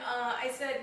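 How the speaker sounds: usual speaking voice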